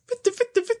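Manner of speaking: jumps way up on each bitte; skipping record. Acted. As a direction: repeat like a scratched record stuck. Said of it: "Seine Stimme klingt wie eine zerkratzte Schallplatte".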